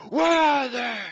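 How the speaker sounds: loud, high, strained roar; hammed-up superhero interrogation shout; gruff Batman yell. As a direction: bellow it through a forced gruff rasp. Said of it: "In Dark Knight, he was kinda going".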